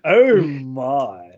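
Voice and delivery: loud, drawn-out catchphrase impression; George Takei. Friend echoes the host's George Takei catchphrase impression. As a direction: savour the catchphrase, swooping and loud. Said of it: "turn it to George Takei".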